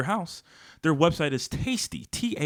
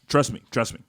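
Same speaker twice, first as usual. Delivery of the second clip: ordinary delivery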